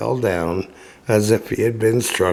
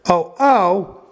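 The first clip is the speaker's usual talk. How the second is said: mock little voice; 7 semitones higher than usual. A narrator's reading. Quoting the little voice.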